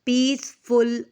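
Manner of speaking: पीसफुल split as पीस - फुल; slow, separate syllables in पीसफुल; broken in two beats. Shown as a demonstration. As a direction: pause after पीस in पीसफुल. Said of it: "टू time".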